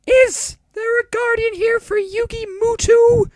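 loud, slow falsetto; put-on female doctor. Doctor seeking Yugi's guardian, loud slow falsetto. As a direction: call out slowly in a very high woman's voice. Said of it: "I have very few female voices".

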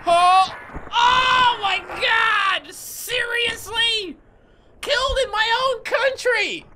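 high, drawn-out wailing shout; anguished outcry. Real, not acted. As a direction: howl in disbelief, stretching the words slowly. Killed in the game, howls.

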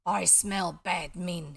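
slow, heavy growl of a sleeping dog. A narrator's reading. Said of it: "he growled".

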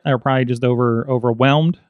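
usual speaking voice

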